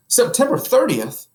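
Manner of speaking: a husband suddenly remembering the date; excited. Acted. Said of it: "in an excited tone".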